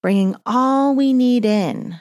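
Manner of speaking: soothing yoga-teacher cues; guided breathing. Acted. Leads breathing exercise.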